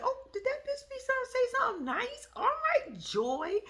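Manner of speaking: high, surprised sarcastic squeal; mock surprise. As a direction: pretend to be shocked she said something nice. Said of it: "Then I said".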